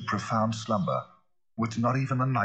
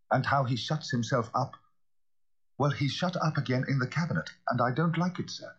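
higher-pitched, uneasy servant voice; worried butler; 7 semitones higher than usual. Acted. Butler tells the lawyer of the doctor shut away, uneasily. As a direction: report the trouble with pitch raised.

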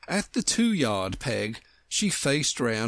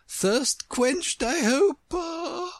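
very high, drawn-out queen voice; gracious Queen; much slower than usual. A narrator's reading. Queen to Alice after the biscuit, high gracious voice. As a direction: inquire high, stretching the end.

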